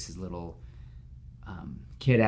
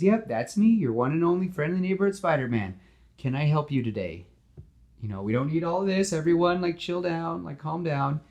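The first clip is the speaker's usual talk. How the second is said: cheerful, chatty superhero; Spider-Man voice; 8 semitones higher than usual. Acted.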